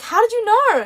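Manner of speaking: high, loud retold astonishment; surprised question. Daughter asking mum how she knew, surprised. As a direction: pitch it up in acted surprise.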